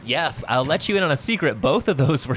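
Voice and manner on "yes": normal talking voice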